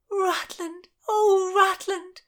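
high, tearful joyful cry. A narrator's reading. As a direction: cry his name through happy tears. Joyful tears.

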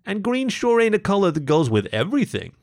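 voiced shopkeeper character. A narrator's reading. Mo remarking on the green ring to a customer, high character voice. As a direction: push it up high and emphatic.

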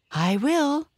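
high, rising eager exclamation; excited cry. A narrator's reading. Pony promising his friends, excited high cry. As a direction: pipe up high, bursting to go. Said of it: "cried Tinkle".

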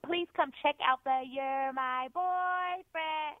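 ordinary delivery

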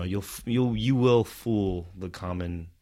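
ordinary delivery

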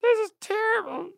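high-pitched helium voice. Acted. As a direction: talk like you just inhaled helium. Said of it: "it's wearing off just a little bit".

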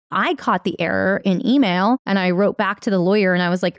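normal talking voice

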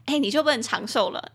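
ordinary talk, nothing marked

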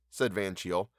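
ordinary delivery, nothing marked

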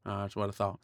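low, quiet warning; hushed threat. Acted. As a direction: say it under your breath.

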